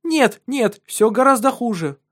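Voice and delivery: high, loud frightened insistence; alarmed. Acted. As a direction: plead urgently, high and loud. Countess insists on fire.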